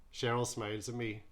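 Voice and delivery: usual speaking voice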